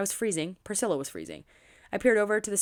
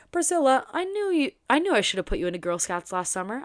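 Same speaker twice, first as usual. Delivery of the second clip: sarcastic; 6 semitones higher, 5 dB louder than usual. Acted. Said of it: "I said sarcastically".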